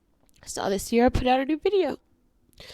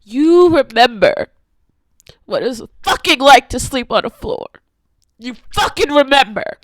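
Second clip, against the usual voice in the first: loud and higher, slowed and pounding; rising rage; 4 semitones higher, 11 dB louder than usual. Real, not acted. Telling viewers about sleeping on floors, in a furious loud voice. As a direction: let the rage boil over on each word. Raging about past poverty.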